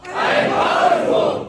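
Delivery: slow, huge shouted affirmation; booming motivational speech. Shown as a demonstration. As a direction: roar the words back at full force. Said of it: "repeat after me".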